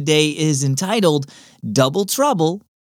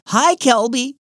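higher mom voice calling a greeting; 12 semitones higher, 3 dB louder than usual. Acted. Neighbor mom calls out to passing boy. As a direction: call it across the driveway. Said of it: "she called out".

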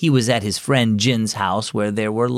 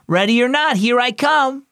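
yelling; 10 semitones higher than usual. Acted. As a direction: call it out across the house. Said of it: "Jin yelled the last number".

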